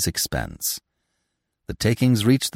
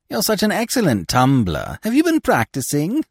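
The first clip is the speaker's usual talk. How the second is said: mocking; 9 semitones higher, 3 dB louder than usual. A narrator's reading. Harlequin mocks fallen Scaramouche.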